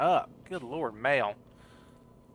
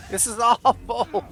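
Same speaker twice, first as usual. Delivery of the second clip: loud, high, drawn-out complaint; anguished wail; 9 semitones higher, 9 dB louder, slower than usual. Real, not acted. Player losing the fight, long loud wail. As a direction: wail it slowly in dismay.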